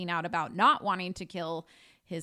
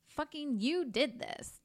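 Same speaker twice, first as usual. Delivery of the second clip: imitated accusation; 8 semitones higher than usual. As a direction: lightly act out an accusing mother. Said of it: "Wendy is like".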